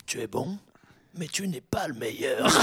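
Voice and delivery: mock solemn speech. Proximo motivating his gladiator, mock solemn. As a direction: hand down the verdict with weighty gravity. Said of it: "il fait un discours, il fait".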